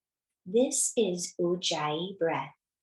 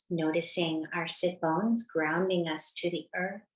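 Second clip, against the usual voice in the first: guided meditation. Acted. Yoga teacher settling the class into natural breath, calm and unhurried. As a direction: ease them gently into stillness.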